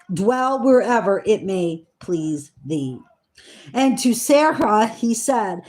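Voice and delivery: measured, solemn Bible reading voice; scripture reading. Acted. Reading Genesis to her listeners, in a solemn reading voice. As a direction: read the old words with weight and care.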